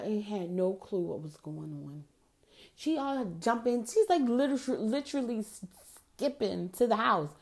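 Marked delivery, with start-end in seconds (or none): none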